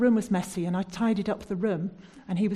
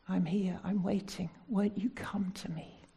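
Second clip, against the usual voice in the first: tender invitation; 5 dB quieter than usual. Acted. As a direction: invite gently, like the Lord waiting. Voicing the Lord tenderly.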